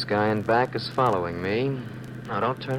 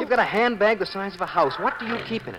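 higher-pitched, exasperated complaint; irritated nagging; 8 semitones higher than usual. Acted. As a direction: needle her with mounting annoyance. Husband keeps nagging wife.

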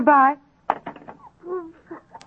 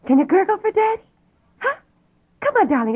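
put-on higher sing-song coaxing for a baby; coaxing baby talk; 7 semitones higher than usual. Mother coaxes baby son to gurgle on phone. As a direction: coax the baby sweetly.

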